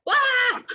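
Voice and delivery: high, loud mock wail; snippy wail. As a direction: let out a silly frustrated wah. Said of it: "we start getting snippy".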